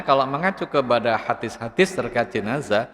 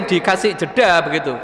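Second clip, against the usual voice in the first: usual speaking voice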